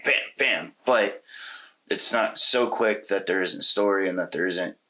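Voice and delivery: usual speaking voice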